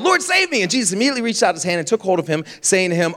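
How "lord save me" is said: high-pitched cry for rescue; reader's mild cry for help. A narrator's reading. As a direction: call out as if sinking, pitched high. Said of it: "he cried out".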